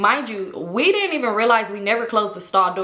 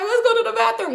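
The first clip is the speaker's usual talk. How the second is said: put-on scared drunk plea; 13 semitones higher than usual. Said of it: "And I was like".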